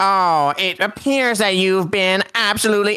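high, slow cartoon squid voice; Squidward voice. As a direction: play a snooty cartoon squid. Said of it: "I'm gonna do the Squidward voice".

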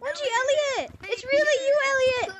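fanboy meeting his hero; starstruck kid. Acted. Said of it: "a kid who just has his dollar store mic".

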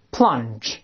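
plunge read clearly as the English word; model reading. Shown as a demonstration. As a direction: say plunge for the class to repeat. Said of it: "我们大声再读一遍".

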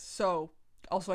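usual speaking voice

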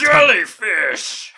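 high, loud laughing yell; laughing shout. Pretend. Mocking shout at a teammate.

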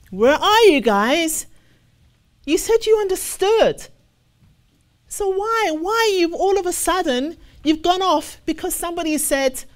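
high, loud challenge to the listeners; calling out. Real, not acted. Preacher calling out believers who fell away, loud and high. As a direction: demand where they went.

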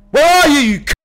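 high, very loud angry yell; furious shout. Real, not acted. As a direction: scream it at someone hiding. Yelling at the hidden enemy.

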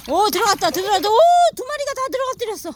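high, loud, fast delight; excited cheer. Real, not acted. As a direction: celebrate both ducks diving. Both ducks dive. (신나서 환호하며)

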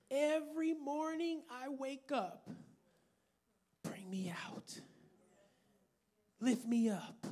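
ordinary talk, nothing marked

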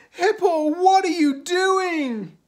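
high, loud, drawn-out outburst; appalled cry. A narrator's reading. Brown Squirrel confronts cookie-snapping Hippo, appalled. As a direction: cry out in disbelief.

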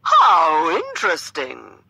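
loud, drawn-out theatrical exclamation; actor's voice. A narrator's reading. Gentleman reacting to her answer, in an actor's voice. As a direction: overact the interest, slowly and loudly. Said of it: "continuing with his actor's voice".

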